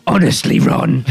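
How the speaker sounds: loud hammy British schoolgirl; mock a rough-voiced Hermione.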